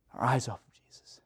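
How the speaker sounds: hushed, whispered echo; sermon point whispered to the congregation; whispered repeat. Real, not acted.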